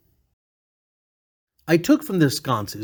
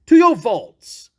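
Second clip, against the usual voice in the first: eager insistence; 6 semitones higher, 7 dB louder, slower than usual. Acted. Fortunato insists on going down.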